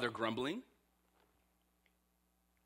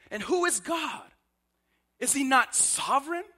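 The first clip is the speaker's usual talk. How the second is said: high, building rhetorical questions; preaching; 8 semitones higher, 3 dB louder than usual. Acted. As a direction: preach each question upward.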